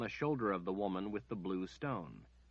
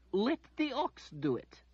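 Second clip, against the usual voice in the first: sniffy dismissal; 5 dB louder than usual. A narrator's reading. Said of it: "she sniffed".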